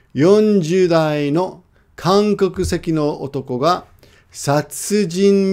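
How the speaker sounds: slow, deliberate Japanese reading; article read-aloud. Shown as a demonstration. Teacher reading a short news article for listening practice, slow careful read. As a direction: read it out phrase by phrase for learners. I'm going to read through it.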